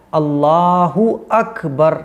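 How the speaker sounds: slow, clear अल्लाहु अकबर with a full u; correct Allahu Akbar. Shown as a demonstration. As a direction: spell out अल्लाहु अकबर slowly for the learners. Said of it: "तो ये सही pronunciation है". (अल्लाहु अकबर धीमे, साफ़ उच्चारण में)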